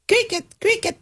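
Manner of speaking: high-pitched cricket chirps in words; imitated crickets. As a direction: chirp like crickets in an awkward silence. Imita grillos por el silencio. (grillos imitados, voz aguda)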